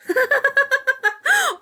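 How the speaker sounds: high-pitched rapid giggle; real high, rippling laugh. Real, not acted. Streamer laughing after admitting forgetfulness, giggling.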